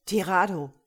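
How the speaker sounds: laut gerufener Name; Ruf ins Funkgerät. A narrator's reading. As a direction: den Untergebenen per Funk herbeirufen. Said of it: "rief er in sein Funkgerät". (lauter Zuruf)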